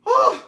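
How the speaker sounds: high, loud startled scream; piercing alarmed scream; shrieked scream. Retold or imitated. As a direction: shriek like the bat just hit you. Retelling the bat scare.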